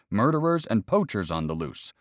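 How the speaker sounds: usual speaking voice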